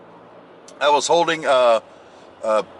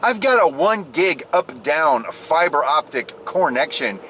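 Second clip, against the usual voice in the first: normal talking voice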